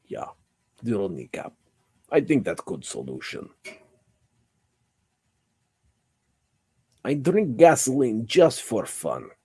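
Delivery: hammed-up Russian dragon voice; Russian accent. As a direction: boast in a mock Russian accent. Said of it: "Did I not hold my Russian accent".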